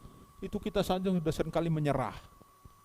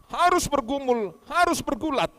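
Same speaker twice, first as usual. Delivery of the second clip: rising exhortation; 5 semitones higher than usual. Acted. Preacher's climax exhortation.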